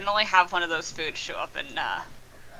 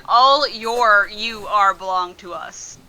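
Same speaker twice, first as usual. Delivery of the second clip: slow quoting; 3 dB louder, much slower than usual. Retold or imitated. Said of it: "he said".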